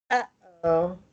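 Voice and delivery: uh-oh with a catch before each oh; glottal stop demo. Shown as a demonstration. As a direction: stop the throat in uh-oh. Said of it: "there's a glottal stop right there".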